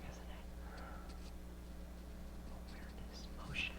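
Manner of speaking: breathy hushed musing; whispering. Real, not acted. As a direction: whisper it like a secret. Musing aloud, suddenly hushed.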